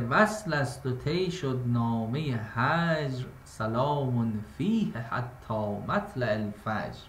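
slow, measured verse reading; poetic recitation. Shown as a demonstration. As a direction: draw out each line like classical poetry.

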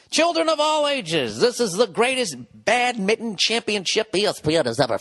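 mock ringmaster introducing badminton; circus ringmaster. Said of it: "in a three-ring circus going".

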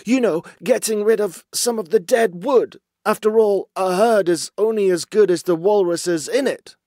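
high, loud underling with a stammering start; nervous. A narrator's reading. Basil hiding desertions from his feared chief, nervous stammering. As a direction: stammer in, then chatter out excuses in a high voice. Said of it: "Clearing his throat nervously".

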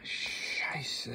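breathy whispered Scheisse; whispered German curse. Real, not acted. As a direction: whisper the swear under breath. Struggling with big samosas.

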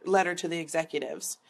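usual speaking voice

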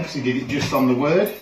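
ordinary talk

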